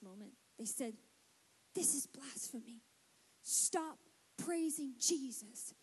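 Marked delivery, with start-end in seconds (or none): 1.7-5.7 very soft, low-pitched disapproval; hushed objection. Acted. Voices objecting religious people.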